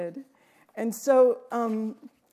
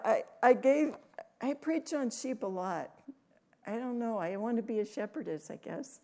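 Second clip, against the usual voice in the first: normal talking voice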